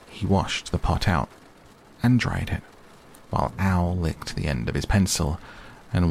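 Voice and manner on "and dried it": ordinary delivery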